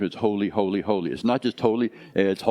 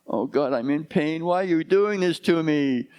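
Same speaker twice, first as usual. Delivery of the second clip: higher-pitched mock lament to God; pleading complaint; 6 semitones higher than usual. Person in pain questioning God, acted plaintive complaint. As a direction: cry out "why me" as an acted protest.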